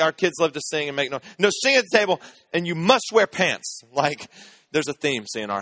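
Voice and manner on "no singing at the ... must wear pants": dead-serious wife. Retold or imitated. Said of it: "as serious as she could be".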